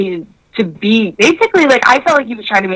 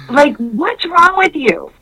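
ordinary talk

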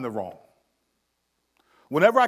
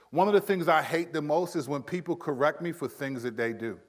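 normal talking voice

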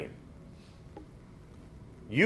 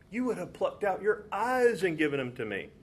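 higher, softer, full of feeling; emotional reading; 6 semitones higher, 5 dB quieter than usual. Shown as a demonstration. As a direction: read it with aching emotion. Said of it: "A lot of emotion in that".

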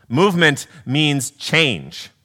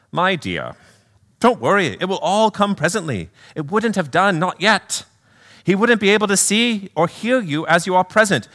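raised, reassuring British spirit voice; British accent; 6 semitones higher than usual. Acted. Spirit soothing his sister, raised British accent. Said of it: "do a little accent work".

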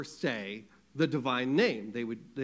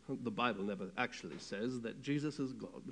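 low, softer mock-British scholar voice; terrible British accent; 4 semitones lower, 6 dB quieter than usual. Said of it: "That's a terrible British accent".

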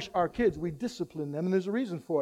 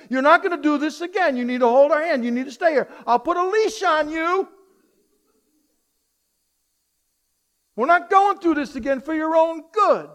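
stern parent lecture; 6 semitones higher, 5 dB louder than usual. Acted. Parent lecturing a found child.

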